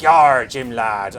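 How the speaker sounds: loud, drawn-out West Country pirate; Geoffrey Rush pirate. Acted. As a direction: growl it out like a stage pirate, slow and loud. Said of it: "the Geoffrey Rush kind of".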